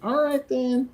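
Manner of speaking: a hint of a defensive kid; retold insistent coworker.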